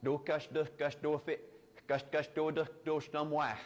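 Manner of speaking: "Do kush duh kush do fi" as the woodchuck line reversed; backwards speech. Shown as a demonstration. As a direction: say the tongue twister sound by sound in reverse.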